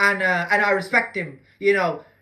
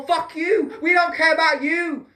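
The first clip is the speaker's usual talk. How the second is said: mock angry shouting; 6 semitones higher than usual. Vlogger mimics politicians telling people off, shouted. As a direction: yell it like a sneering politician.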